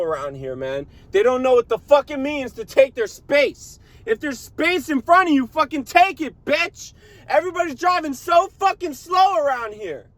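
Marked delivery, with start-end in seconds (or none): 1.1-10.1 road rage rant. Real, not acted. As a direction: yell it up high, fed up with the traffic. Road rage rant about slow drivers.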